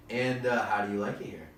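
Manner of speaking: ordinary talk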